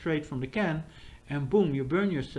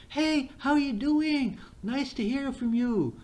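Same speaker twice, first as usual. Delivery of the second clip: much higher, slow and bubbly, like a chatbot greeting a friend; upbeat AI voice; 10 semitones higher, slower than usual. Retold or imitated. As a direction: sing out a warm, emotional hello. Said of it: "a very emotional, upbeat way of talking".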